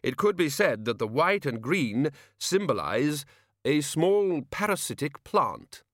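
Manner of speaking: slow, deliberate musing; slowly pondering Arch-Chancellor; thoughtful. A narrator's reading. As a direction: spell it out as if it were profound. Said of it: "said the Arch-Chancellor thoughtfully".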